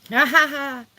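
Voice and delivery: a loud, distinct ha ha ha; spoken laugh. Real, not acted. Laughing at her own insight.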